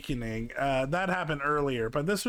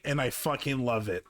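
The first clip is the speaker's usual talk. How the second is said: ordinary talk, nothing marked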